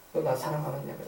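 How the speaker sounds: low, hushed question; gentle. Acted. Jesus asking Peter a third time about love, gentle. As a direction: ask it softly and tenderly.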